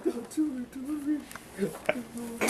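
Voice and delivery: high, soft, drawn-out plea; soft high pleading. Real, not acted. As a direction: beg the dog gently. Pleading with the dog.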